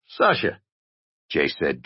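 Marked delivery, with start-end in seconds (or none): 0.1-0.6 high-pitched put-on voice; short, high imitation of someone else; Sasha. A narrator's reading. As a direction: fake another person's voice, pitched up. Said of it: "trying to talk like Sasha".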